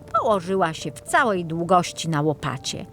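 ordinary delivery, nothing marked

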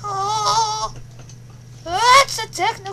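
very high, loud whiny voice. Pretend. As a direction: whine it out, very high. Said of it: "Why aren't you in a whiny voice?".

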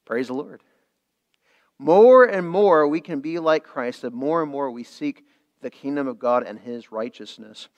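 ordinary talk

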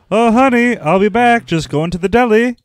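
high, loud sing-out to a partner; mock cheery husband. Teasing friend as husband calling to Kelly, mock cheery. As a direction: call out a casual errand, hammed-up and high.

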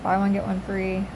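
normal talking voice

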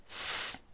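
스 whispered unvoiced; S sound. Shown as a demonstration. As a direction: breathe out 스 with no voice. (무성음으로 스)

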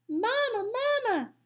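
put-on high-pitched baby gosling call; peeping gosling. A narrator's reading. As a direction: cry out like a tiny hatchling. Gosling calls to robot again.

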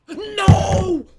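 very high, very loud scream of no; despairing all-out shriek; anguished scream. Real, not acted. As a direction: scream it as it all falls apart. Dies at end of match.

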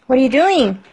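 normal talking voice

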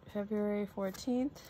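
ordinary delivery

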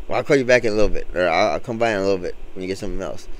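quick, low backing-out excuse; hurried excuse. Retold or imitated. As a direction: rush the excuse to get away. Customer politely backing out.

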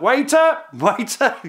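cheeky Tommy shouting back across no man's land; mock Cockney shout. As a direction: holler it like summoning a waiter. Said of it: "the British would shout".

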